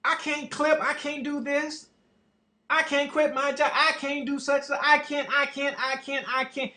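high, repetitive mock whine; put-on voice of someone making excuses; mocking excuse-maker. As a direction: mimic a helpless complainer, pitched well up. Mocking people who say can't.

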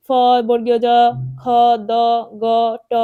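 ফ, বর্গীয় জ, খ, দ, গ, ট each held apart with a pause; letters one by one. Shown as a demonstration. Teacher showing how to read letters, one by one. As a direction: recite ফ to ট as separate letter sounds. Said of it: "এভাবে পড়তে হবে".

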